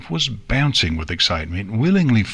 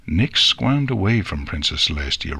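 plain reading voice

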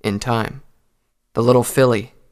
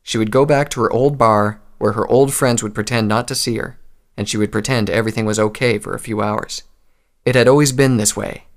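plain reading voice

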